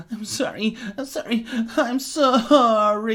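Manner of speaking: sorry repeated, from sobs to a loud slow wail; sobbing, building to a wail. Acted. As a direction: break down harder on each sorry. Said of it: "erupted into huge chest sobs".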